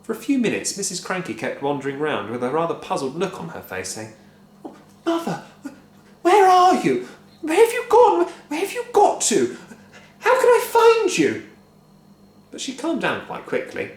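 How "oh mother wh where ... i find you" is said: high, loud calling; searching wife. A narrator's reading. Wife wandering round calling for her mother, high. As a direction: call around for her.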